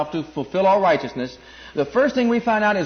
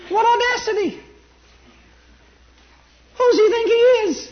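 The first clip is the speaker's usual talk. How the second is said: much higher, indignant preacher's cry; outraged exclamation; 4 dB louder than usual. Real, not acted. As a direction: cry out at the nerve of it.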